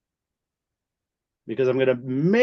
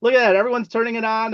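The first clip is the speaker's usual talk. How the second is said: excited greeting; 7 semitones higher, 4 dB louder, much faster than usual. Real, not acted. Greeting a guest on camera.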